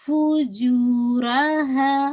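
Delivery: very slow فجورہا with a heavy را and the ہا held for two counts; Quran recitation. Shown as a demonstration. Teacher recites the word slowly for her students. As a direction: chant فجورہا slowly, thick on را. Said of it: "را کو heavy".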